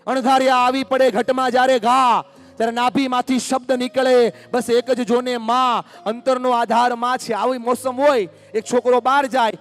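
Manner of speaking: high and loud, pouring it out; impassioned preaching. Real, not acted. Speaker preaching to families about a mother's love, impassioned.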